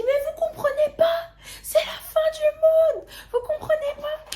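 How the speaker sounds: very high, loud, laughing sobbing shriek; panicked crying. Retold or imitated. Crying to a young woman that the world ends. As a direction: wail it in mock despair.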